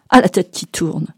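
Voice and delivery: usual speaking voice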